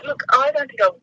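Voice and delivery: usual speaking voice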